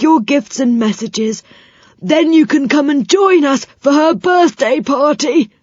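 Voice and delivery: put-on high, loud, jolly old man voice; cheery saucepan man promising help. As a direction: reassure the children brightly.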